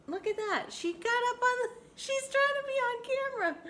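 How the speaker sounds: high, loud delighted exclamation; excited. Real, not acted. Delighted at her pet climbing toward the camera.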